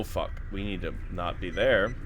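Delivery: normal talking voice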